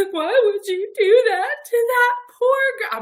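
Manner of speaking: high, loud half-laughing distress; aunt on the phone, mock outrage; upset aunt. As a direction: plead on the poor girl's behalf, mock-hurt. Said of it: "my aunt phones me and is like".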